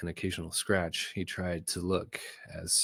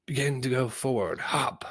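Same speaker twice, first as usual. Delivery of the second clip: called order; 6 semitones higher, 4 dB louder than usual. Acted. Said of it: "the commander called".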